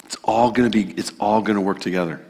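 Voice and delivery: soft, low and quick, like a hushed promise; quiet reassurance. Shown as a demonstration. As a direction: murmur it gently to comfort. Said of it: "when she can say the words".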